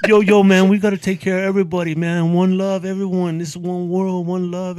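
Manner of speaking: high, loud laid-back bro voice, fast; mocking stoner bro. Mocking a lazy freeloader, high and loud bro voice. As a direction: ham up a lazy one-love bro. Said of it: "Who you mocking?".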